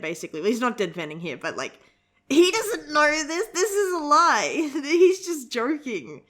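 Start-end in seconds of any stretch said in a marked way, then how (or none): 2.3-6.2 laughing. Real, not acted. Laughs over the joke.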